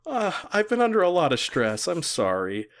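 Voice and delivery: a hammed-up heartbroken sigh of a ruler; heartbroken apology. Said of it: "the way [name] just delivers the line, like, he's actually heartbroken".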